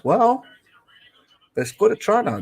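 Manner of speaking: high excited shout of a try; excited outburst. Real, not acted. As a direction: pitch jumps way up on the news. Reacting to a try.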